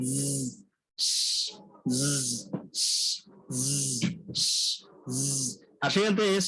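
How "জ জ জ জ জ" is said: জ held as a buzzing z; z sound demo. Shown as a demonstration. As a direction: repeat জ as a long z hum. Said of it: "উচ্চারণ হবে জ". (জ-কে গুঞ্জনের মতো z উচ্চারণ)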